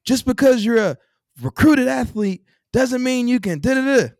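high, mocking imitation of a barking upperclassman; yelling upperclassman. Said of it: "got yelled at too by upperclassmen".

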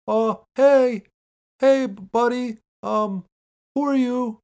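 put-on a silly high naive teen voice; mock childish Spider-Man. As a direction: ham up a nervous little kid. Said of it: "Why do you talk like that?".

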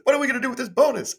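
bubbling over about free money; excited. Retold or imitated. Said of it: "it's like".

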